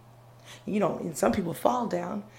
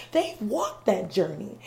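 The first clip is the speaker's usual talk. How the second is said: ordinary talk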